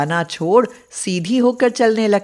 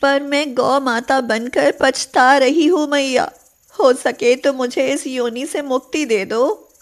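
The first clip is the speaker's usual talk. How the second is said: higher, plaintive plea; pleading cow; 5 semitones higher than usual. A narrator's reading. Cow Radha pleads with goddess Durga, higher and plaintive. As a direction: beg for release.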